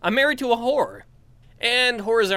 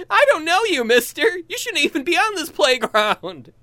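put-on little girl voice; 11 semitones higher, 5 dB louder than usual. Child voice scolding stranger.

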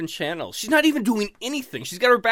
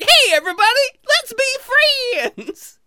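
very high, loud elf squeal, drawn out at the end; gleeful high-pitched cartoon elf; happy friendly elf; 6 dB louder, slower than usual. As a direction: greet everyone as an overjoyed elf. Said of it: "inside me is this happy".

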